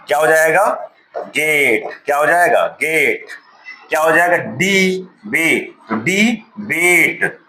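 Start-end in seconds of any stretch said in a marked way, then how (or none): 4.4-7.4 डी बेट and classmate stretched slowly; teacher slowly builds classmate from its parts; slow sounding out. Shown as a demonstration. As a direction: draw out classmate syllable by syllable. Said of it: "ऐसे करके spelling हम लोग सिखाते हैं".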